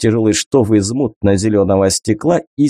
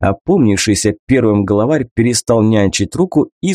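ordinary delivery

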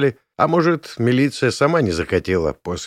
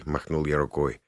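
plain reading voice

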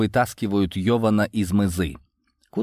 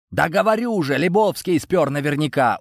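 higher-pitched, insistent claim; confident assertion; 7 semitones higher than usual. A narrator's reading. Йован accusing Лебовский of stealing, confident. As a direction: insist with full certainty. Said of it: "уверенно заявил Йован".

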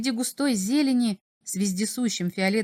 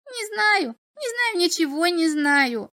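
high, drawn-out imp whine; whining; 9 semitones higher, slower than usual. A narrator's reading. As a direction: whimper it like a sulky imp. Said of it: "заскулил хвостатый собеседник".

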